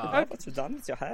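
put-on German accent. Friend joining in on the quoted compliment, German accent. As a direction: echo the line in a mock German accent. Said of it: "He should have a German accent".

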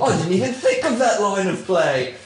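high, loud, hammed-up caster voice; mock British commentator at full excitement; Raven. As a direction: lose your mind like a British announcer. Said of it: "I'm Raven".

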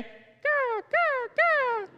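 very high squawked bird caws; three piercing mock bird calls; pretend bird caw. Dad pretending to be a bird at bedtime, high cawing. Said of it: "Pretending to be a bird".

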